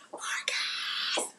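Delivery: whispered name; breathy whisper of a call; muted yell. Real, not acted. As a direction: hiss his name under your breath. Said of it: "mute and yell at this kid".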